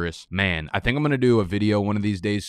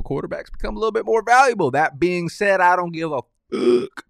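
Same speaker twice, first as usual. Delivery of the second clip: high mock voice; 10 semitones higher, 4 dB louder than usual. Mock high voice dismissing Superflex.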